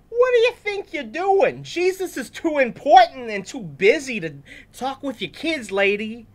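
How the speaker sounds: high, loud mock scolding; hammed-up angry disciple. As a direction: shoo the parents away, indignant. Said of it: "that's probably how they sounded".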